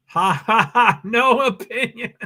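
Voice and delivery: bursts of real laughter through the words; laughing. Real, not acted. Streamer reading chat, laughing at no opinions. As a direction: laugh it out, amused. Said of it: "laughing at chat comment".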